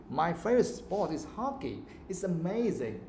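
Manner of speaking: "hockey" and "amazing" lifted with lively pitch; positive intonation. Shown as a demonstration. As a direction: make "amazing" go up like good news. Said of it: "That's how you say it".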